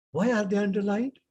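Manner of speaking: ordinary talk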